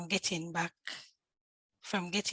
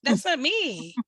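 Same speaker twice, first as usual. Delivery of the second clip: incredulous denial; 6 semitones higher, 9 dB louder, much slower than usual. Retold or imitated. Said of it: "I was like".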